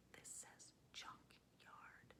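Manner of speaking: very soft, voiceless whisper; whispered aside. Real, not acted. Whispered aside reading a sign.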